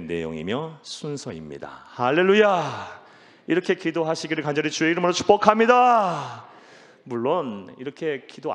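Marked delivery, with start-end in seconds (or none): 1.9-6.4 loud, ringing blessing with a long-held ending; booming pulpit blessing; preacher's proclamation. Real, not acted. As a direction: proclaim it at full force to the congregation.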